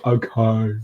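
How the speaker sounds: druid voice. Retold or imitated. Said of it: "That's my druid voice".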